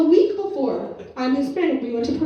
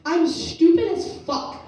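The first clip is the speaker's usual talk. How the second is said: ordinary talk, nothing marked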